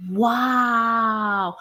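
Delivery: long, drawn-out gasp of wonder in words; awestruck. Acted. Marveling at something magnificent, awestruck. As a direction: let one word swell in amazement.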